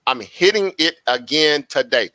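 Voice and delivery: each word spaced out for emphasis; measured, emphatic restatement; slow, deliberate. Real, not acted. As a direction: drive it home slowly, word by word. Repeats it slowly for emphasis.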